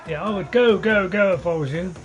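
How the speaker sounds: mock urging. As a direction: shoo them off, half joking. Teasing enemy in the game.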